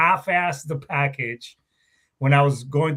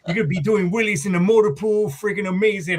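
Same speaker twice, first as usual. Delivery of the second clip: mock salesy recruiter pitch, pitched higher; hyped-up recruiter; 5 semitones higher than usual. Recruiter hyping up a young recruit, mock salesy voice. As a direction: sell the dream like a slick recruiter. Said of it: "and the recruiter saw me".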